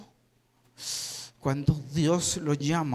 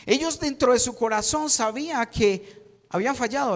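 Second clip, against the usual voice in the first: ordinary delivery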